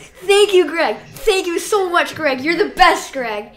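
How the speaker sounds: screaming with joy, voice cracking; overjoyed fan yelling thanks; ecstatic shouting. Real, not acted. Shoutout in livestream chat.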